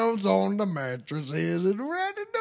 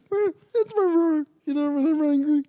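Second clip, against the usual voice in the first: high squeaky voice; 8 semitones higher, faster than usual. Acted. Odd high voice explanation.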